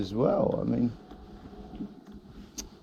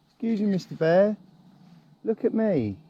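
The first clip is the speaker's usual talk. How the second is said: higher, sing-song polite request; sweet talking a bear; 8 semitones higher than usual. Real, not acted. Cyclist politely coaxing a bear to pose, sing-song. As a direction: coax the bear gently, higher than usual.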